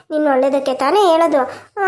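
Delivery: normal talking voice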